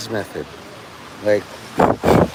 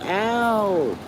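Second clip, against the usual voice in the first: sharp, higher pretend ow; mock yelp of pain; 7 semitones higher, 5 dB quieter than usual. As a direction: yelp like nicked by metal. Cutting himself on a can.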